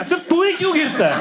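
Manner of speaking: exasperated, high-pitched parent imitation; mock parental scolding. Parent to child who fell in the building, mock scolding.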